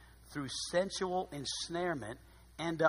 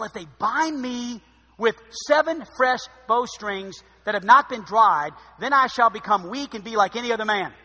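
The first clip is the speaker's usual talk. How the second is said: louder, emphatic reading of the story; scripture reading; 6 semitones higher, 13 dB louder than usual. A narrator's reading. Preacher reading Samson's story to the congregation, emphatic. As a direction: read the verses out with preaching force.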